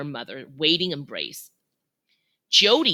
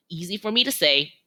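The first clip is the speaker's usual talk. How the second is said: usual speaking voice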